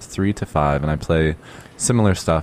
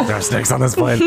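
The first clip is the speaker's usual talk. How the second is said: movie quote; 5 semitones higher than usual. Acted.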